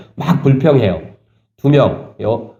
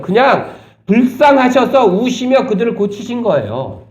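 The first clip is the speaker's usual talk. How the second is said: raised, impassioned preacher's voice, high and pressing; fervent preaching; 9 semitones higher than usual. Acted. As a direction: preach it with fire to the congregation. Preaching passionately about healing. (높고 열정적인 설교 톤)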